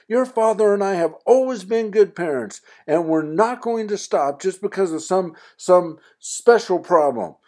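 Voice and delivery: high, loud motherly lecture; scolding mother. Acted. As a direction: lay down the law as mom. Mother lecturing son angrily.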